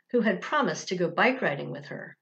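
ordinary delivery

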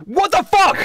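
outraged yell. Acted. Trevor outraged at being healed.

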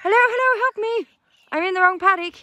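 high, loud pleading goat voice; crying goat's plea. Retold or imitated. Farmer voices her goat crying through the fence, high and pleading. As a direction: voice the stuck goat begging for help. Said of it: "she's just crying".

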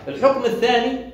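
usual speaking voice

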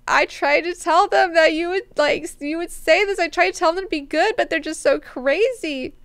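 high, pleading retold woman's voice; lightly acted, high-pitched protest; mock woman's plea. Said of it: "she's like".

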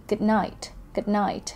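slow Good night with night stressed, good light. Shown as a demonstration. Teacher slowly models Good night, stressing night. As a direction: lean on night, let good fade. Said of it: "เราจะเน้นเสียงหนักนะคะ ที่ night พยางค์ที่สอง".

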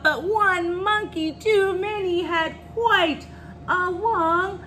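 high, drawn-out read-aloud climax; dramatic story reading. A narrator's reading. Reader tells children of waterfall plunge, dramatic storytelling. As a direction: stretch the last word like a long fall.

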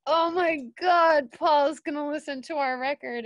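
imitating stunned, nervous excitement; retold starstruck panic. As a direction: play awestruck fans losing it, easing off toward the end. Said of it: "guys were just shitting bricks".

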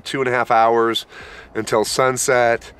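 ordinary talk

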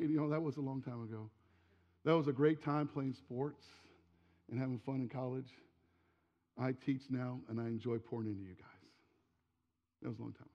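a teacher quietly setting the past aside; soft, reassuring reply to a student; gentle teacher. Retold or imitated.

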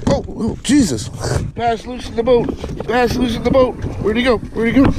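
high, urgent alarm, half-amused; excited mild panic; flustered. Real, not acted. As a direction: pitch up, scrambling after a loose fish. Fish loose in the boat.

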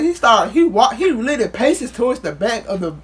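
ordinary talk, nothing marked

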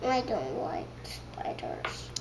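whispered, stubborn little no; whispering. Real, not acted. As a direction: whisper it to yourself. Child repeats, in a hushed voice.

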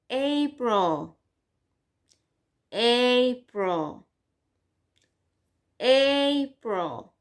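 "April" with a long, stretched "ay" at the start. Shown as a demonstration. Teacher drilling pupils on the long "ay" in "April". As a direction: draw out the "ay" of "April" clearly. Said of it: "That's a long sound".

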